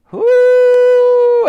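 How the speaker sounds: loud, very high, long hoot; mammoth hoot. A narrator's reading. Wooley joins the soldiers' cheer, hooting. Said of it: "added Wooley".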